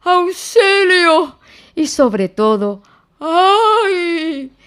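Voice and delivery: slow, high, loud wail from inside a jar; muffled cry for help. A narrator's reading. Pooh trapped in the jar, muffled wail for help. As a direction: call out helplessly, head stuck.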